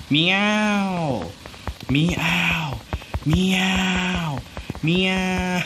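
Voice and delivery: high, drawn-out meow with falling end; mock cat meows. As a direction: human imitating a cat's yowl. Meowing as the cat.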